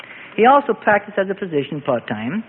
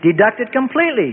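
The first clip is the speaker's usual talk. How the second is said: matter-of-fact; 7 semitones higher than usual. A narrator's reading. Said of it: "Joseph said matter-of-factly".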